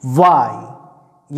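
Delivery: वाई drawn out slowly; teacher repeating the model Y; slow Y demo. Shown as a demonstration. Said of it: "उसको जल्दी बोलना है".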